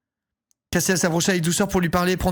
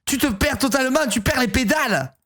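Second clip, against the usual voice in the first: put-on Marseille accent; 2 semitones higher than usual. Brother confronting his sister, Marseille accent. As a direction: scold in a mock Marseille accent. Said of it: "Nathaniel aura un accent marseillais".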